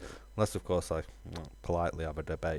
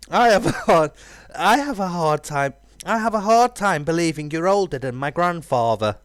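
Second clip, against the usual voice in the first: high, loud playful doubt; mock the dragon's age; teasing disbelief; 12 semitones higher, 14 dB louder than usual. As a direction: hero mocking the dragon's claimed age. Voicing the hero character.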